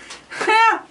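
short high squeal of a laugh. Real, not acted. Laughing at himself.

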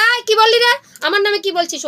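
high, loud furious demand; angry challenge. Acted. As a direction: roar at the jackals who insulted him. Arjun overhears jackals, challenges them.

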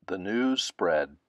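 usual speaking voice

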